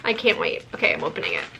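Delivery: ordinary delivery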